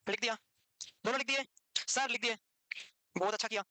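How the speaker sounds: usual speaking voice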